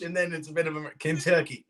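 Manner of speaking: usual speaking voice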